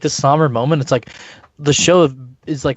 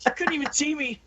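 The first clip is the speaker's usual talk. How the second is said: mocking voice; 11 semitones higher, 9 dB quieter, faster than usual. Mock quote, high.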